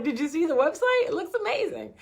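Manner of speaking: giddy high voice showing off a website; high, excited self-quote. Retold or imitated. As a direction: squeal it like the site is perfect.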